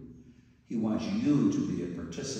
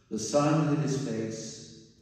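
ordinary delivery

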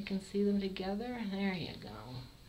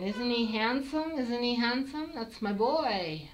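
higher, louder proud cat mom; 5 semitones higher, 6 dB louder than usual. Pretend. Breeder voicing the mother cat in the background, higher and louder. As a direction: boast about her son like a proud mother. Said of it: "that's Mom in the background saying".

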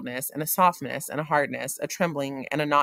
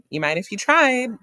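teasing; 8 semitones higher, 8 dB louder than usual. A narrator's reading. Girl teasing Montag.

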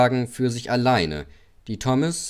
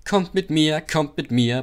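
puffing; 5 semitones higher, 3 dB louder than usual. A narrator's reading. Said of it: "paffte er".

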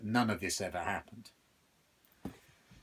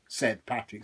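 plain reading voice

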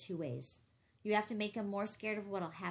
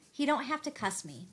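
angry; 4 semitones higher, 6 dB louder than usual. A narrator's reading. Quarrel escalating.

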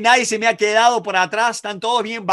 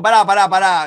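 loud, insistent mock protest; retold protest; 7 dB louder than usual. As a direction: cut in loudly to stop someone. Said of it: "Entonces van a decir los tipos". (protesta imitada, fuerte e insistente)

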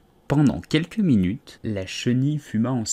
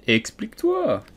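higher, clipped stern command; stern caterpillar; 10 semitones higher than usual. A narrator's reading. Caterpillar sternly orders Alice to explain herself. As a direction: demand it sternly from above. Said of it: "d'un ton sévère".

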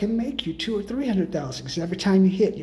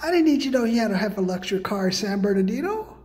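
ordinary talk, nothing marked

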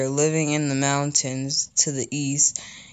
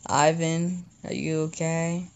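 usual speaking voice

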